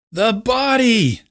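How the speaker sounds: high, slow, loud scream. A narrator's reading. Ed screaming past the crowd, high and loud. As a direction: scream it out in horror. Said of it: "Loyce screamed".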